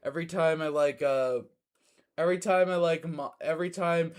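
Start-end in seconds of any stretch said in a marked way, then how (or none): none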